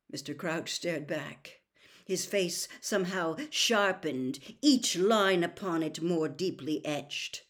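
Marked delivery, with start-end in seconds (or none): none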